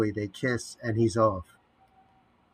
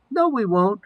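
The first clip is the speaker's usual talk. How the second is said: much higher, less masculine voice; feigned high voice; 11 semitones higher, 7 dB louder than usual. Acted. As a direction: squeak it like a doubting sidekick. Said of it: "Feigning high, less masculine voice".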